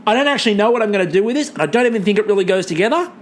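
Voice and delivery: rushed, stubborn mock contestant; fast defiant Poe. Poe refusing to trade, fast and defiant. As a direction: rattle it off, clinging to her stuff. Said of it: "She's like".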